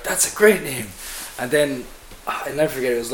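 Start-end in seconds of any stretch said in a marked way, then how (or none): 0.0-0.9 excited. Retold or imitated. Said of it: "and we're like".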